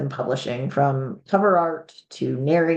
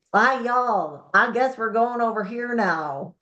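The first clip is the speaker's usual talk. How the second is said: hammed-up Southern drawl, pitched higher; overdone Southern accent; 5 semitones higher, slower than usual. Shown as a demonstration. Warning authors off overacting, an exaggerated Southern accent. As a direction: lay the Southern accent on thick, as a bad example. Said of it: "a little bit of a Southern drawl".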